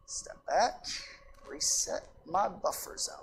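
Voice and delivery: calm yourself under your breath; soft self-calming aside; quiet self-reminder. Real, not acted. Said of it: "I felt the edge creeping up".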